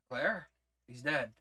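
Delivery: ordinary delivery, nothing marked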